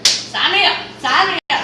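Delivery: high, loud, thrilled sign me up; eager shout. Retold or imitated. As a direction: jump at the offer, loud and bright. Eagerly accepting salon offer.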